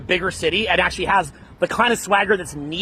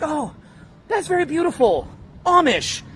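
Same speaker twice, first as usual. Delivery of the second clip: mock admiration; 8 semitones higher than usual. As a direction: coo it politely in a raised voice. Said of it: "we're like".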